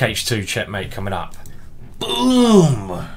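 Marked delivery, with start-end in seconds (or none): 2.0-3.1 triumphant boom. Real, not acted. Checkmate lands, triumphant exclamation.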